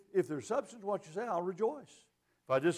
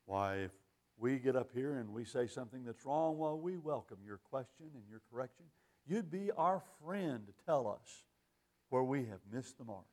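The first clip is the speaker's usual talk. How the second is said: low, soft imitation of a preacher's pious humility; gentle mock of a sanctimonious preacher; mock humble preacher; 6 semitones lower, 6 dB quieter than usual. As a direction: put on a preacher's meek, syrupy piety. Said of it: "You've heard preachers say".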